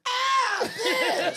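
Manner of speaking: high, loud swooning shriek of "Ah, Biz!"; fangirl scream. Retold or imitated. Telling how he met [name], a star-struck squealing scream. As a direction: squeal "Ah, Biz!" like a starstruck fan, laughing. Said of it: "I screamed and I swooned".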